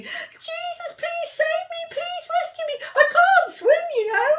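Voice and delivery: high, desperate begging; pleading for help. Acted. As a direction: cry out to be rescued. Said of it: "he starts shouting".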